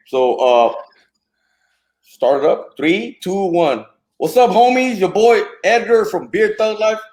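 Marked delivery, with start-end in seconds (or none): 4.2-7.0 loud, high hype host greeting; hype vlog intro. Acted. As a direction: pump up the crowd like a YouTuber host.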